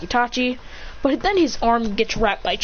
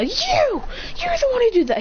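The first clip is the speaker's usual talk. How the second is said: high-pitched accusing mock yell; acted crowd yell, no great volume. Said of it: "they all yell".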